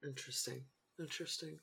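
flat, dry "interesting"; dry deadpan. Real, not acted. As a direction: say it drily, unimpressed. Dry reaction to fraternity talk.

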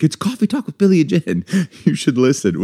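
high, upbeat retold reply through a laugh; retold cheery plug. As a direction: play the listener proudly plugging the show, amused. Retelling listener's answer to driver.

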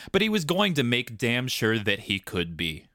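plain reading voice

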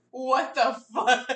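loud, drawn-out disbelief with laughter; retold shock. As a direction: act out being stunned, half laughing. Retold shock at friend's news.

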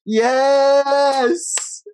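excited. Real, not acted. Exclaiming at the match.

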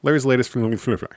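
a fast tongue-twister rush through the beer name; said quickly. Shown as a demonstration. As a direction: rattle off Larry's Latest Flamingo Fruit Fight fast as one breath. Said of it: "I'm gonna try that quick".

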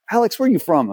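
mock challenge, tossed up high; pointed question. Spivey challenging Alex about where he's from, teasing question. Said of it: "he looks at me and goes".

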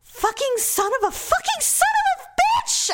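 high-pitched, re-enacted pain cursing; pissed. Woman who stabbed her arm, high furious cursing re-enacted. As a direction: curse through it in mock fury. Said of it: "I was so pissed".

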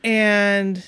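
ordinary delivery

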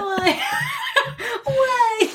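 high squeaky laugh into a wail of why. Real, not acted. Still reacting to the fleet story, high laughing why. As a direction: crack up and cry out.

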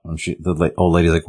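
ordinary delivery

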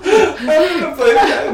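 high laughing tease; laughing while teasing. Real, not acted.

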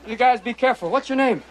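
ordinary delivery

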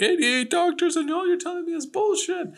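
hammed-up high-pitched imitation of an exasperated mom; mock outraged mother. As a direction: play the fed-up mother in falsetto. Acting out mother yelling at doctors.

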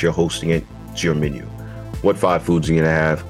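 ordinary talk, nothing marked

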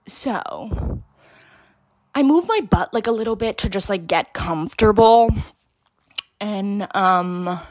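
usual speaking voice

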